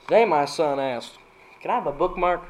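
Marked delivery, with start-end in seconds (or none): 1.6-2.4 mock kid voice. Son's quote in dad joke.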